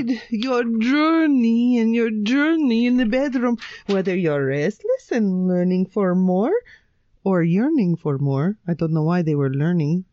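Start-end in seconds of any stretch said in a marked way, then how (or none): 0.0-8.6 badly faked Swedish accent for ad copy; attempted Swedish accent. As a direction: read it in dodgy Swedish accent. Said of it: "This is your best Swedish accent".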